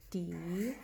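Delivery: normal talking voice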